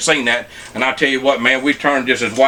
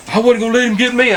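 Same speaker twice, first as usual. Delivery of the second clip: mock scared friend; 9 semitones higher than usual. Friend explaining why he clung to the cot, mock high voice. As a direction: ham up his buddy's defensive excuse.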